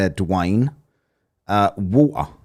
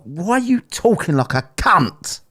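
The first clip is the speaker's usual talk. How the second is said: mock exasperation; 8 semitones higher than usual. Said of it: "it's like".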